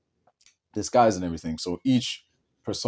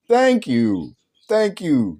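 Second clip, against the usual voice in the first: emphatic thanks; 4 semitones higher, 6 dB louder, much slower than usual. Real, not acted. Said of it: "That's what I'm talking about".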